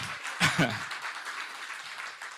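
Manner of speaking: quiet, nearly silent laugh; soft laugh. Real, not acted. Speaker pausing before thanking people, soft laugh. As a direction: let a soft laugh out to steady yourself.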